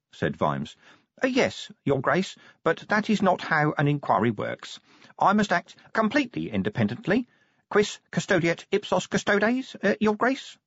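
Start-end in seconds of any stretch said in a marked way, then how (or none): 1.2-10.6 high, precise official voice; prim little inspector, insistent. A narrator's reading. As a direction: insist politely in a high, fussy voice. Inspector A E Pessimal's character voice.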